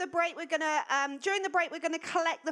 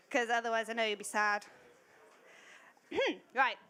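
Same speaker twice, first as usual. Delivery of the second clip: normal talking voice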